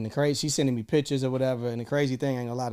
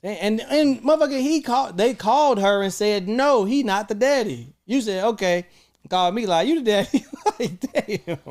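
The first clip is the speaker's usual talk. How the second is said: higher and louder, laughing at the end; worked-up rant; 10 semitones higher, 6 dB louder than usual. Real, not acted. Man ranting to cohosts about the lab calls, loud and high. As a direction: get fired up retelling the calls.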